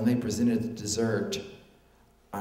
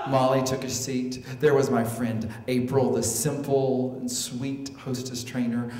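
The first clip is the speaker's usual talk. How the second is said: ordinary talk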